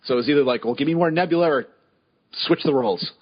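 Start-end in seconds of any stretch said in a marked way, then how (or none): none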